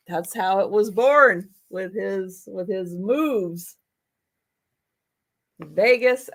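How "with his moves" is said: normal talking voice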